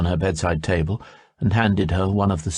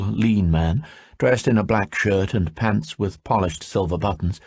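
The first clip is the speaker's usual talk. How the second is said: ordinary delivery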